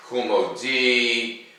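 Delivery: ordinary delivery, nothing marked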